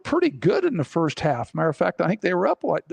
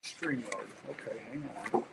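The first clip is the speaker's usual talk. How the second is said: echoing tunnel; 4 semitones lower, 13 dB quieter than usual. Real, not acted. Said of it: "you sound like you're in a tunnel".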